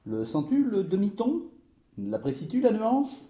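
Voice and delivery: high-pitched, finicky questioning; playful, high rising questions; teasing connoisseur. Acted. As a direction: savour the nuance in a high voice.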